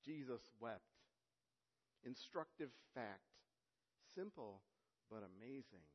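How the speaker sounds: earnest, oratorical reading of Spurgeon; formal quotation reading. Acted. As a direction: read the old preacher's words with conviction. Reads Spurgeon quotation aloud.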